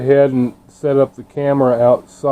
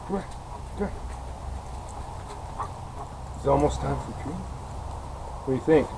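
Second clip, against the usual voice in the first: soft dog talk; 15 dB quieter, faster than usual. Real, not acted.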